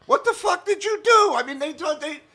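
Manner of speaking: very high-pitched retold amazement; incredulous agent. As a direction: imitate an agent who can't believe it, voice shooting up. Voicing his amazed agent.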